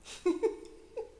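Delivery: high-pitched giggling burst; laughing. Real, not acted. Storyteller tickled by their own tale, laughing. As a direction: let out a high, breathy laugh.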